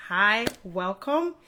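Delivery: ordinary talk, nothing marked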